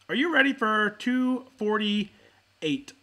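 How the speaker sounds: slow, drawn-out emcee question; hype call. Real, not acted. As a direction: stretch it out like a show host. Opening call for the episode.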